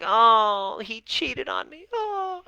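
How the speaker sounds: very high-pitched, hammed-up crying whine; wailing victim. Coach mimicking a betrayed partner, hammed-up victim wail. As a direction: play the victim with a mock sobbing wail. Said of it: "when you're the victim, you're like".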